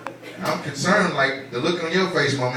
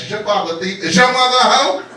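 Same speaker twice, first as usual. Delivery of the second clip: imitated comeback, rising high and loud; woman's backlash; 6 semitones higher, 3 dB louder than usual. Woman answering a pickup line, mock backlash. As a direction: build from mocking echo to loud retort.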